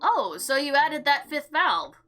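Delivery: ordinary talk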